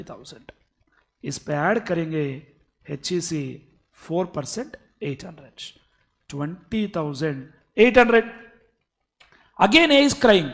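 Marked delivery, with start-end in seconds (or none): none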